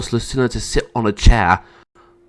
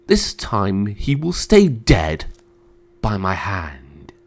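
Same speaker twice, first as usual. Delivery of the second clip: menacing; 2 semitones lower, slower than usual. Acted. Emperor threatening to kill his brother, menacing. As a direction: draw it out coldly, fading at the end.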